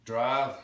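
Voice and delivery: loud "Drive" as wide "drahv"; "Drive" with a big flattened I; Texan pronunciation. Shown as a demonstration. Teacher demonstrating Texan, "Drive" as "drahv". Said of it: "Big wide vowel for drive".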